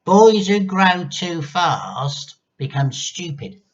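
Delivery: put-on slow, loud, spiteful old woman; grandma scolds George with slow spite; slow menacing grandma. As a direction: lean in and sneer each word. Grandma voiced in story.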